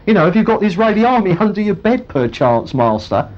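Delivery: squeaky high "Where? Where?" with laughter, then quick; panicky servant, mocked. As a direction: play the servant looking round in a comic panic. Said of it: "you imagine the servant starts to look round".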